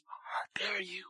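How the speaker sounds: a hint of a scandalised whisper; lightly voiced indignant God; mock offended God. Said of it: "God ain't gonna be shamed and say".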